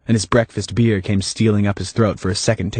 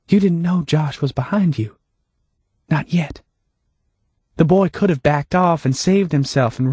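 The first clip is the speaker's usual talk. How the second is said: higher, lightly voiced Hannah; Hannah, calm and knowing; 4 semitones higher than usual.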